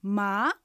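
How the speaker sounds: rising "ま", as if querying; second tone. Shown as a demonstration. Teacher demonstrates second tone, rising like a query. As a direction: swing "ま" upward like a "huh?". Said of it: "聞き返すように上がる音です".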